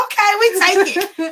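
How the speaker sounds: gushing, delighted yes; excited high quote. Retold or imitated. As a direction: squeal it out, slow and high. Acting out their excited acceptance.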